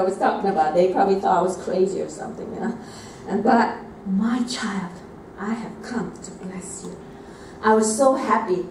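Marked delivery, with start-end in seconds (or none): 4.1-7.0 gently voiced blessing, softening at the end; Jesus. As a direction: repeat a blessing tenderly, trailing into softness. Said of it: "Jesus said to me".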